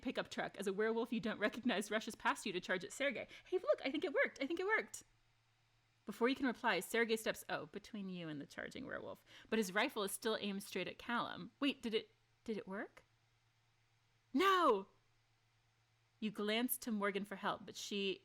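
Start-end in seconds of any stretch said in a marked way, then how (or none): 3.4-5.0 higher, bright relieved voicing; half-whispered relief in character; light character voice. Character tells Layla the hiding worked, voice higher. 14.3-14.9 loud, high cry; real alarmed no. Real, not acted. Streamer cries out as the rifle turns on Callum.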